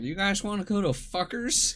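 put-on high, sweet old lady voice; old grandma voice. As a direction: imitate a real old grandma. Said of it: "but she's like".

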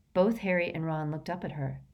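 ordinary delivery, nothing marked

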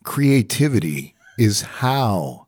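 slow, quoting a friend; imitating a friend. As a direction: recite it the way he does. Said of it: "You said it just like him".

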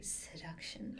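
slow, breathy, low-key sultry title; seductive purr. Shown as a demonstration. Showing she can't say the title in an ordinary voice, seductive purr. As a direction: draw the word out softly, suggestively. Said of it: "like, an ordinary voice".

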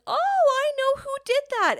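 put-on high, eager old-lady voice; imitating Nana. Nana ratting out her granddaughter, high gleeful mimic. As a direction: squeal it like a gleeful granny. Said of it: "she butts in and says".

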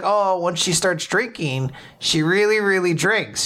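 a hint of a high granny voice; elderly helper praising the baby's appetite; lightly voiced elderly helper. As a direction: coo a little over the baby. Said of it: "they're like".

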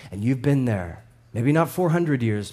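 ordinary talk

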